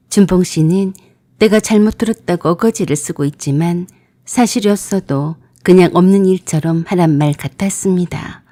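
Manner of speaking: ordinary delivery, nothing marked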